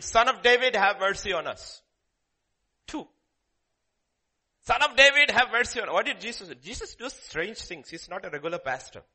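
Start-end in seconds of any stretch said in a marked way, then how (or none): 0.0-1.8 crying out for mercy. Retold or imitated. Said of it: "crying out". 4.6-5.9 louder and higher, begging; loud plea. Retold or imitated. Two blind men crying out to Jesus, loud and begging. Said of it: "crying out".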